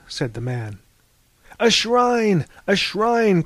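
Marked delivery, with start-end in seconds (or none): 1.6-3.4 higher, eager call of a shrine; overjoyed exclamation; excited cry. A narrator's reading. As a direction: cry it out with sudden delight. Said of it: "cried Harvey".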